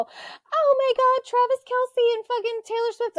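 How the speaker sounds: high-pitched mocking squeal of fandom; imitated breathless fangirl excitement; mock fan gushing. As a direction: gush like a starstruck teenager, sarcastically. Mocking gushing fans she quotes.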